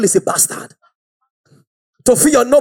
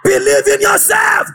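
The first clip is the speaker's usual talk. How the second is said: full preaching; 5 semitones higher than usual. Acted. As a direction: fire up the congregation.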